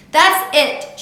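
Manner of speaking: loud, high, furious yell; angry yell. A narrator's reading. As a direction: yell it at the child in full anger. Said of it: "she yelled".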